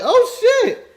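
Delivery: sudden high, loud gasp of disbelief; stunned oh shit. Retold or imitated. Recalling the moment he got chills, stunned. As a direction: blurt it out, caught off guard. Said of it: "I was like".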